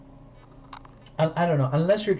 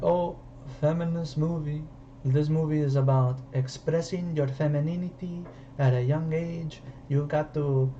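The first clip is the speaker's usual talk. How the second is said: mimicking a French director's accent, softly; mock French accent; 3 dB quieter than usual. Critic mocking a French director's feminist claims, put-on French accent. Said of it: "She's got a fucking French accent".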